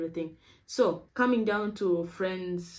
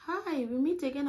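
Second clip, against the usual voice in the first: lightly voiced friend, higher and bright-toned greeting; cheerful friend's greeting; 8 semitones higher than usual. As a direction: play the friendly girl pleasantly surprised. Friend Shweta greets her.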